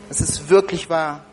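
normal talking voice

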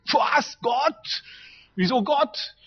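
high, shouted Was and Gott; stunned disbelief. Retold or imitated. Businessman shocked by the preacher's answer, shouting in disbelief.

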